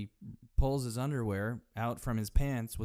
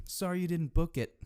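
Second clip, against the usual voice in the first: high mock voice; 6 semitones higher than usual. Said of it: "Mugatu's derelict campaign".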